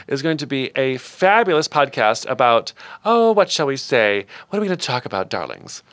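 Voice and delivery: ordinary talk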